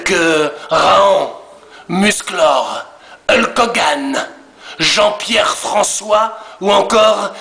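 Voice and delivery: slow, measured, aggrieved voice of the mocked guest; Mercedes. Mercedes confronting the chat members who mocked him, slow and menacing. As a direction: drag every insult out slowly, a wounded tough guy threatening revenge. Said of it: "de faire venir Mercedes".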